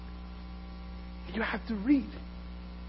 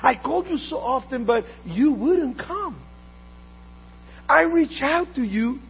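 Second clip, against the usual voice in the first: preaching wisdom's call; 10 semitones higher, 7 dB louder than usual. Acted. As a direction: plead as wisdom from the pulpit. Voices wisdom calling.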